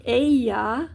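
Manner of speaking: "Ella" with the double L said as a Y glide. Shown as a demonstration. Teacher showing learners Spanish double L, "Ella" said "eh-ya". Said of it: "double L in Spanish is ya".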